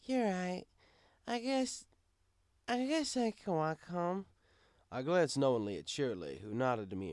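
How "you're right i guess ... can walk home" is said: high, slow tipsy giving in; concede, sleepy and sloppy; high, slow drunk mare giving in; resigned drunk sigh. Acted. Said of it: "Twilight sighed".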